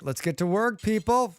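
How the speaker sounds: rallying call. Acted. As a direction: rouse the workers, rising. Rallying colonists.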